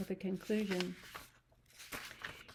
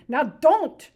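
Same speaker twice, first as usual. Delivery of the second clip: much higher, louder angry order; angry Lenny; 9 semitones higher, 17 dB louder than usual. Acted. Lenny to screaming Curly's wife, angry order. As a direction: bark at her to stop. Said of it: "Lenny grew angry".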